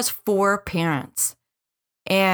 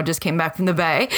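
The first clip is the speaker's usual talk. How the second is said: ordinary talk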